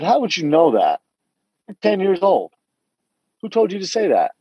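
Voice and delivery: put-on questioning a child who can't know this; incredulous. Pressing his ten-year-old niece on who told her, incredulous.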